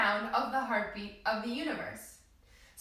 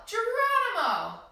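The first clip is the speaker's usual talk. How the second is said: yelled; 8 semitones higher, slower than usual. Shown as a demonstration. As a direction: shout it as you jump. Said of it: "we're gonna yell".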